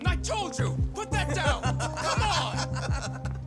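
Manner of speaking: high furious yell across the street; angry shout. Acted. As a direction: bellow at the kids to stop. Angry owner yells at kids.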